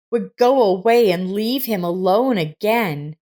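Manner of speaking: usual speaking voice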